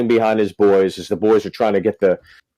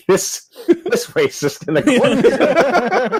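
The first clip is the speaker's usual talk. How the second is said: laughing; 13 semitones higher, much slower than usual. Real, not acted. Punchline of the fight story.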